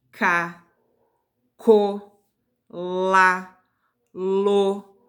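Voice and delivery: cada sílaba destacada e mais alta; sílabas em voz alta. Shown as a demonstration. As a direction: lista de sílabas marcada, pausada. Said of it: "a gente vai falar as sílabas em voz alta". (professora recitando sílabas para a turma repetir)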